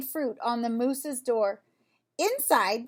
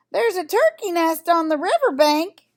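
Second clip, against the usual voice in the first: calling out; 5 semitones higher, 7 dB louder than usual. A narrator's reading. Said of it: "Sheep called".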